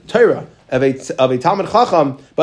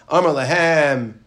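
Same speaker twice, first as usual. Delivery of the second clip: normal talking voice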